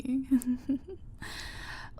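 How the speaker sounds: rising mocking giggle; mocking villain laugh. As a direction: laugh at her misfortune.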